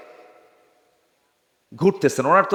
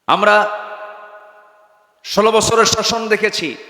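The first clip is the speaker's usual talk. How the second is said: high, loud, chanted litany; rousing preaching; 10 semitones higher, 7 dB louder than usual. Real, not acted. Preacher lists past governments to the crowd, rousing high cry. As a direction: call out each phrase at full voice, pausing between.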